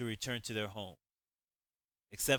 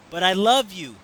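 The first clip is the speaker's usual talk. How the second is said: loud, high pleading cry; desperate cry; 9 semitones higher, 15 dB louder than usual. Acted. Janelle begging Javon in the rain, loud high cry. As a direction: throw the love at him as a last plea. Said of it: "her voice continued to tremble".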